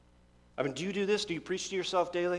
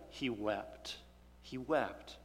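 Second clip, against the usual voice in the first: hushed sorrow; 7 semitones lower, 13 dB quieter, much slower than usual. Acted. Paul weeping over false believers.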